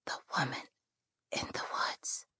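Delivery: soft dying whisper; barely breathing, whisper it; hushed suspense. Acted. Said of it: "the dying girl whispered".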